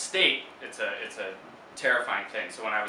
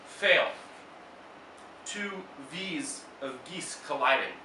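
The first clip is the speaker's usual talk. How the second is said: poem reading; 6 semitones higher than usual. Acted. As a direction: read each line like a spell, with long pauses. Reads second poem aloud.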